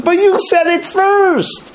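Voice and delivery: high, slow, put-on whiny protest; husband protesting. Husband defends himself to wife, high and whiny. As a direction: drag it out like a sulking husband. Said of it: "So the husband says".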